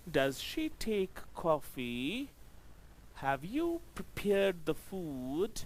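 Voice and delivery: each yes-no question "Is he there?" ending on a slow rise; rising yes-no questions. Shown as a demonstration. Teacher shows the yes-no question rise to the class, slow and lifting. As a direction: ask slowly, lifting every question at the end. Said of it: "yes, no type ... begin with helping verbs".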